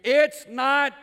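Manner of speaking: loud, high mock complaint; imitated child's protest. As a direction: belt it out like a protesting kid. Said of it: "have your kids said to you".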